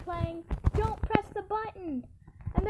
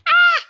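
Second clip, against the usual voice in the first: high loud shriek; startled scream; 10 semitones higher, 15 dB louder than usual. Real, not acted. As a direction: shriek in alarm.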